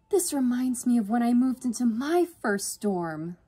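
high, loud nostalgic gush; swooning. A narrator's reading. Sister reminiscing in the new dorm, swooning. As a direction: swoon over the fond memory. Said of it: "Yvonne swooned".

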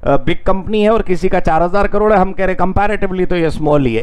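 normal talking voice

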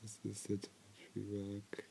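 hushed unvoiced whisper. Real, not acted. Viewer whispering to herself during the show. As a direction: whisper it under the breath.

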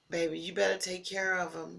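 usual speaking voice

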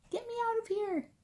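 very high, small cry for help; tiny voice. A narrator's reading. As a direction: squeak it out like someone little. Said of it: "said a tiny voice".

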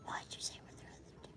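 fast hushed whisper. Real, not acted. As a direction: whisper it quickly. Whispering on stream.

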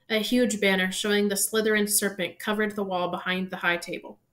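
usual speaking voice

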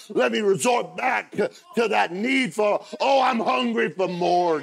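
loud, high, pleading prayer to Jesus; shouted prayer. Acted. Preacher praying aloud to Jesus, loud and high.

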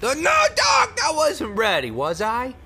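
high-pitched, loud comic cry; mock panic. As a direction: play a hammed-up panicked victim. Acts out panicked free-trial user.

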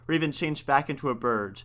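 ordinary delivery, nothing marked

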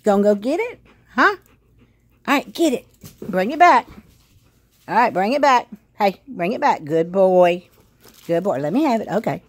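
high, loud puppy-talk praise; praising the dog. Real, not acted. Owner coaching her dog through fetch, high and loud. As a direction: squeal encouragement at a dog fetching.

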